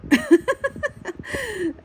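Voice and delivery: a high, loud burst of laughter; high squealing laugh. Real, not acted. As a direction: squeal with laughter at your own mistake. Laughing at own drawing.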